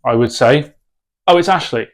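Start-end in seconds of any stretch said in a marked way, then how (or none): 1.3-1.9 brief, breezy reply; short answer. Shown as a demonstration. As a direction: give the bare minimum. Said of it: "answer their questions in as short of a response".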